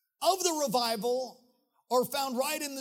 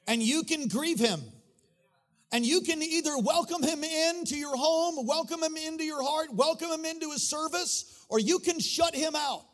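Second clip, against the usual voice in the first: high, driving sermon delivery; full-force preaching; 6 semitones higher than usual. Real, not acted. Preacher urging the house to welcome the Holy Spirit, full-force preaching. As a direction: preach it with rising fervor, stretching key words.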